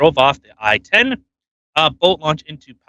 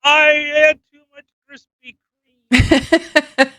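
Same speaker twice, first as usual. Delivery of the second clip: high-pitched, hammed-up cop voice; mock cop; 9 semitones higher, much slower than usual. Host mocking the cop who was going to help, high silly voice. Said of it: "The cop that was going to help them's like".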